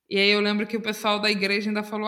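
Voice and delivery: usual speaking voice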